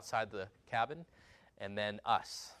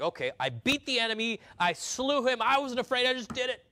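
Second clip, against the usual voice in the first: triumphant believer; 10 semitones higher, 4 dB louder than usual. Retold or imitated. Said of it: "we're like".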